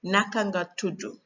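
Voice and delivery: Senegalese-accented Wolof, tudu ending in "oo". Shown as a demonstration. Teacher showing how to ask a name, Senegalese accent. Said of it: "in Senegalese accent".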